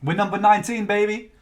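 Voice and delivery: high, loud triumphant brag; excited. Real, not acted. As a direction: crow it out to the chat. Announcing chart position to viewers.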